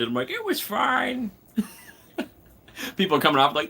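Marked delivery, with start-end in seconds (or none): 0.3-3.0 put-on old man voice. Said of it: "I'm becoming such an old man".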